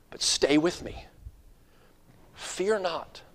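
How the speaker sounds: soft, gentle comfort with long pauses; tender reassurance. Acted. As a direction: reassure a frightened child quietly. Christ comforts a despairing child.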